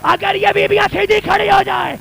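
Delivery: put-on a courtier pleading for the child, wailing; wailing lament. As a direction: cry it out in grief. Climax of court scene.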